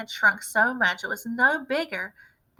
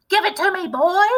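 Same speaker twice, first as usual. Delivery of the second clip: much higher, louder demand; yelling; 9 semitones higher, 8 dB louder than usual. A narrator's reading. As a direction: bellow for the cup. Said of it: "yelled Grandma".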